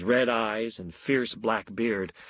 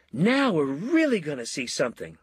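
mild excited yell; 5 semitones higher than usual. A narrator's reading. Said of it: "Eddie yelled".

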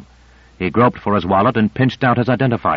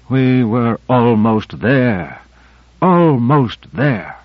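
slow, drawn-out, despairing; despairing mutter; much slower than usual. Acted. Gonder muttering to himself over dying Benesch, slow and despairing. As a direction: let it sink slowly, lost hope. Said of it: "muttered despairingly to himself".

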